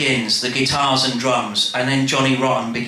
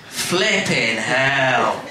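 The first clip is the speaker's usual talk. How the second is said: laughing; much slower than usual. Acted. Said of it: "Penny says".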